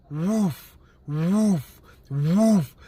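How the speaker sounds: drawn-out imitated whoosh; whooshing sound effect; whoosh of passing cars. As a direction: imitate cars zooming past, one by one. Imitates cars flying past.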